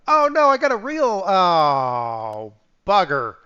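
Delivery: sudden loud oh no, rising to a curse; alarmed oh no. Real, not acted. Streamer yelping at a game mishap, alarmed. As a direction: caught off guard, yelping then cursing.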